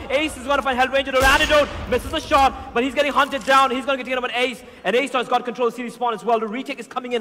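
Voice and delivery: excited play-by-play call; esports caster. Acted. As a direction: call the round fast and hyped. Hyped play-by-play.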